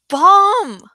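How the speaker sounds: slow, high, loud scream of alarm. A narrator's reading. Narrator screams to the shoppers, full-lung alarm. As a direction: yell it to clear the store. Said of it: "I screamed at the top of my lungs".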